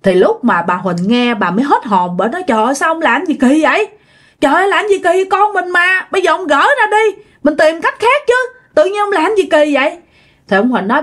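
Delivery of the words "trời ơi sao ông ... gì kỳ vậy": high, fast, alarmed pleading; shocked protest. A narrator's reading. Wife begs husband, shocked, to remove the stake. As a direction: plead in horror for him to undo it.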